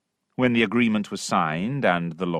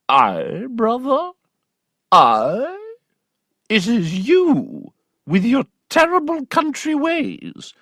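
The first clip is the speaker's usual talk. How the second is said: high, outraged aunt voice; the aunt rears up, offended; a high, scandalised retort to her brother; indignant aunt; 14 semitones higher, slower than usual. Acted.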